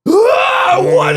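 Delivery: very high, loud mock scream; panicked scream. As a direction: shriek in acted-out terror. Acting out panic in tilting car.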